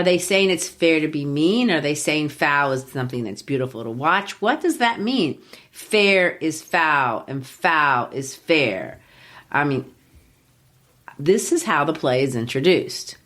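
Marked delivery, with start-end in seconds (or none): 5.7-9.0 drawn-out, lower verse line; slow, deliberate quote. Acted. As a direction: weigh each word slowly, like a riddle. Quotes witches' riddle, slowly.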